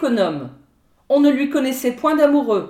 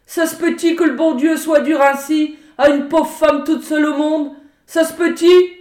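high, tearful falsetto whine, louder; whining peasant woman; 5 semitones higher, 5 dB louder than usual. A narrator's reading. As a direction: plead in a put-on pitiful wail. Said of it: "ces paroles larmoyantes et filées en fausset".

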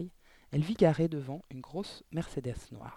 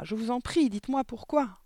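high, desperate begging; pleading cry; 8 semitones higher, 3 dB louder than usual. A narrator's reading. Girl begging her teacher to explain, pleading cry. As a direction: beg for the truth, pitched high. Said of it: "cria Mathilda".

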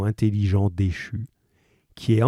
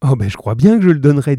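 fast, louder, higher assurance; emphatic mother; 5 semitones higher, 9 dB louder, much faster than usual. A narrator's reading. Mother assures the man she will give it, fast and emphatic. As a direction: blurt it out eagerly.

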